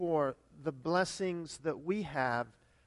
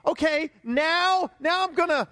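eager new believer; 10 semitones higher, 7 dB louder than usual. Retold or imitated. Disappointed believers imagined, eager high and loud. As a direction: play the overexcited convert. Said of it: "they think".

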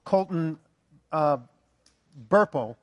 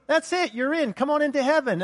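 cheery Saint Peter; 8 semitones higher than usual. Retold or imitated. Peter welcoming her into heaven.

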